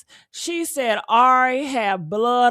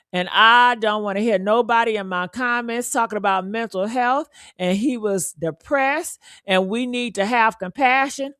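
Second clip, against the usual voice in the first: ordinary talk, nothing marked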